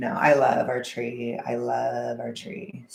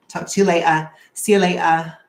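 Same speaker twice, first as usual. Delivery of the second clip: bright loud sign-off; 3 semitones higher, 9 dB louder than usual. Real, not acted.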